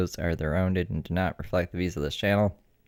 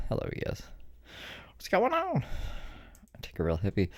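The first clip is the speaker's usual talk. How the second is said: out of breath; 6 semitones higher, 8 dB quieter than usual. Real, not acted. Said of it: "I'm out of breath".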